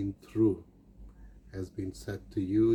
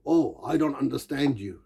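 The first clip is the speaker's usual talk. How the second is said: mock rude, higher and louder; 4 semitones higher, 5 dB louder than usual. Awkward stranger brushing off the listener, mock rude. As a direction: snap it like a socially awkward stranger. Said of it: "And that sounds rude sometimes".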